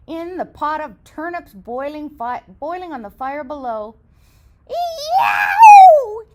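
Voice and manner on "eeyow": high loud yelp of pain; wolf scream. Acted. Wolf landing in boiling turnips, high scream. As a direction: scream as if scalded. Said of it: "He leapt up with a scream".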